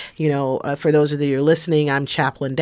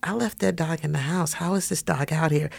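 hushed retelling; 7 dB quieter than usual. Retold or imitated. Friend's puzzled words, retold.

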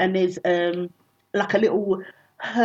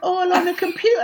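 mock host patter; 11 semitones higher than usual. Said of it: "I go".

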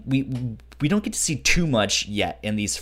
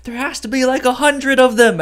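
high, loud panicked exaggeration; mock alarm at the size of the enemy force; panicked soldiers; 8 dB louder, faster than usual. Soldiers on the cliff path panic over enemy numbers, loud and high. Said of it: "they're sitting there like".

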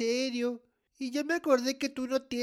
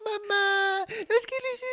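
high-pitched crying into garbled words; unintelligible wailing; 8 semitones higher than usual. Acted. Child on phone to mom, hysterical crying. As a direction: blurt it out and dissolve into sobs. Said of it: "así no te entiendo".

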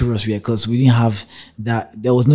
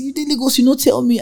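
high, surprised retort; mock-surprised uncle voice; 4 dB louder than usual. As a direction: act out the uncle's surprise, pitched way up.

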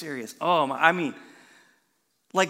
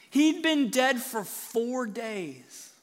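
usual speaking voice

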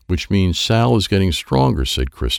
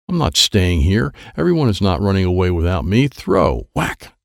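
ordinary delivery, nothing marked

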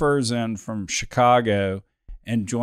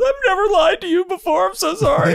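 very high, louder falsetto mock apology; imitating apologetic Stacy; 5 dB louder than usual. Boss mimics assistant Stacy confessing she lied, in a high falsetto. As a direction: gush it out like a guilt-ridden assistant. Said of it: "Stacy's in my office".

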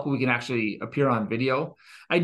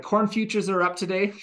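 North Dakota accent; 6 semitones higher than usual. Shown as a demonstration. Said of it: "spice up that North Dakota accent".

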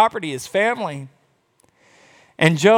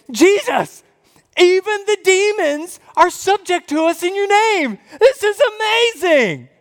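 much higher, thrilled, breathless with amazement; excited disciples; 12 semitones higher than usual. Retold or imitated. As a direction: squeal it like you can't believe your luck. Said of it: "it's right to be excited about that".

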